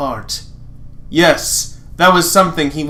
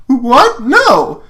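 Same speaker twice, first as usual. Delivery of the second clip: high, loud yelp of denial; startled denial; 9 semitones higher, 6 dB louder than usual. Acted. Lance denying a trick to Lotor, high and loud. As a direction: jump up high, caught off guard.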